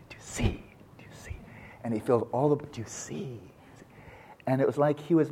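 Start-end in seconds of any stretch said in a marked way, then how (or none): 0.1-1.4 hushed, breathless whisper, slightly lower. Retold or imitated. Old professor at the blackboard to his students, awed whisper. 2.7-3.9 whisper. Retold or imitated. Old professor at the blackboard to his students, awed whisper.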